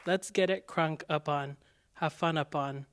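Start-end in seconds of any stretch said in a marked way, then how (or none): none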